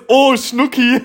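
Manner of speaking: high, loud and giggly; excited mock gushing. Real, not acted. Joking to a friend about texting Niklas, excited.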